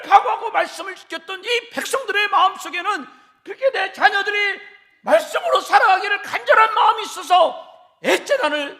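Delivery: loud, high fervent preaching; full force preaching. Acted. Pastor urging parents loudly in fervent preaching. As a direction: drive it home to the congregation, loud.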